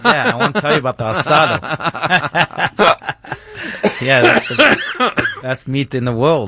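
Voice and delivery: talking through real laughter, high-pitched; high, giggly delivery broken by laughs; laughing. Real, not acted. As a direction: laugh helplessly while getting the words out. Amused by the steak remark.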